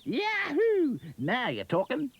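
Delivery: high, bouncy Aussie voice; a cheery kangaroo exclaiming; Australian kangaroo. Acted. Kangaroo Rupert's voice.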